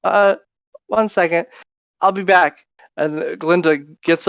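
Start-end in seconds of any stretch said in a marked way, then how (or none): 0.0-2.6 high, flustered fairy calling out; fluttering, panicked little fairy. Acted.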